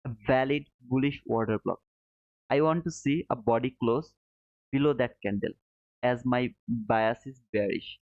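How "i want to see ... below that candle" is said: normal talking voice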